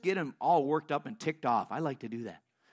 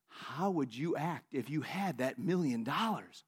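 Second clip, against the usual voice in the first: slow, low, patient voice of God; the Lord speaking; 5 semitones lower, 6 dB quieter, slower than usual. Retold or imitated. The Lord asks the praying man once more, slow and low. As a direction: repeat the question low, drawing out every word. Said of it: "And the Lord said again".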